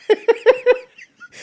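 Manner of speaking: loud high burst of laughter. Real, not acted. Laughing hard at the comment about the shoes. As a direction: crack up loudly at the joke.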